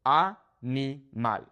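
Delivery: Animal in slow pieces, ah, nee, mahl; Spanish "Animal", each syllable apart. Shown as a demonstration. Teacher makes it easier to pronounce, Animal in slow Spanish syllables. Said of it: "break it up into syllables".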